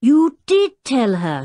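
high, loud, drawn-out contradiction; child's emphatic protest; insistent shout. A narrator's reading. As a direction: shout back at your father. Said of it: "shouted Tinker".

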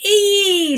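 high yelp; pained ee. A narrator's reading. Errol climbed on by Edith, high yelp. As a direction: squeak it out. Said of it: "said Errol".

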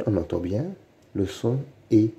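usual speaking voice

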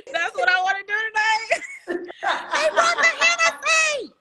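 high, loud, laughing cheer; laughing shout. Real, not acted. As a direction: whoop with laughter. Celebrating the Hennessy.

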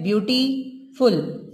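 slow "ब्यूटीफुल", paused before "फुल"; syllable by syllable. Shown as a demonstration. Teacher counting syllables for students, "ब्यूटीफुल" said slowly in two parts. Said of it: "ब्यूटीफुल में दो syllable हैं".

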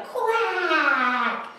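long, high quack; gleeful duck quack. Acted. Duck having a blast driving, gleeful high quack. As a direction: draw the quack out high like a whoop.

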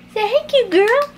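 high sing-song phrase for a parrot; teaching a parrot. Shown as a demonstration. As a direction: pitch it up so the bird copies it.

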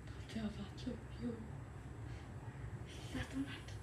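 unvoiced hushed aside; whispering. Real, not acted. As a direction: whisper so nobody hears.

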